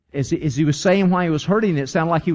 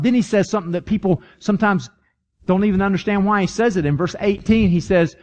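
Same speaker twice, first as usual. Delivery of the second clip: normal talking voice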